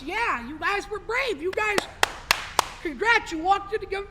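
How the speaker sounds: normal talking voice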